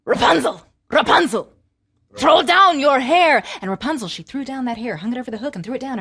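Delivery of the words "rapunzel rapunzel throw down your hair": calling up. Acted. Sorceress calls.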